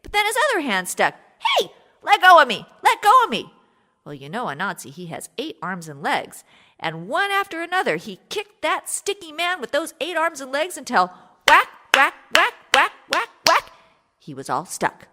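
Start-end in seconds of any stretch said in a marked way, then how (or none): 1.4-3.5 high, loud desperate pleading yell; panicked yell. Acted. Ananse stuck, panicking. 11.5-13.7 whack sounds. A narrator's reading. Kicking sound effect.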